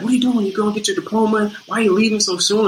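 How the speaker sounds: high-pitched, hurried scolding; mock mad. Co-star confronts him about quitting college, high-pitched mock anger. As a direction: fire off questions, high and quick, acting mad. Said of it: "Comes in mad".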